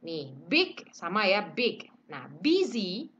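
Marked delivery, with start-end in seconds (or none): 2.4-3.1 busy, said slowly with a short i; model word busy. Shown as a demonstration. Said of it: "tulisannya B U S Y".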